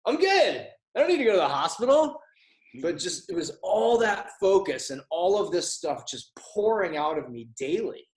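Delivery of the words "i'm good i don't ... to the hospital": high, loud, chirpy all-clear; exaggerated relief. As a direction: blurt it out like you're suddenly cured. Retold sudden recovery after workout.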